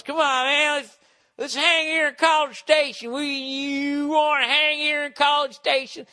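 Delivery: put-on high, loud drunken pestering; loaded drunk guy. Drunk jerk badgering strangers to stay, loud mocking imitation. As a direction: push it up high like a pushy drunk. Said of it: "he's completely loaded".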